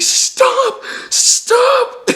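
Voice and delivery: high, loud mock scream; shouting stop. Kids flagging down the truck, high loud shout. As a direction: wave down the truck yelling.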